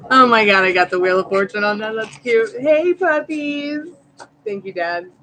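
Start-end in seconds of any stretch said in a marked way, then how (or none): 0.1-2.5 loud, bright squeal of delight; loud excited gush. Real, not acted. Excited at a stream gift. 2.6-3.9 much higher, drawn-out pet voice. Real, not acted. Talking to her puppies.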